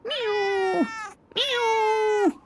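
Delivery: high drawn-out meow; high pleading meows; high cat meow. A narrator's reading. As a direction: mew like an impatient cat. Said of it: "cried Simpkin".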